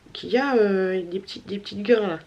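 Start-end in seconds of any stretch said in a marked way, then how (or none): none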